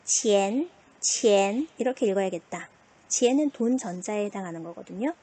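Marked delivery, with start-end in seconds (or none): 0.0-1.7 치엔 in a rising second tone; Mandarin second tone. Shown as a demonstration. Demonstrating for pupils, 치엔 in rising second tone. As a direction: let 치엔 climb upward. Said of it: "이 성이네요".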